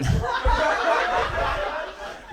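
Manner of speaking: airy burst of laughter mid line; wheezy high laugh; breathless laugh. Real, not acted. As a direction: break up laughing.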